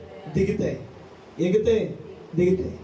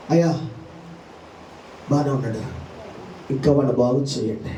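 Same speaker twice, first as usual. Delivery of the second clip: lower, humbler angel reporting to God, a laugh at the end; angel answering God; 10 semitones lower than usual. Acted. Angel reports to God about the son, lower and humble. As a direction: lower voice as a servant reporting back.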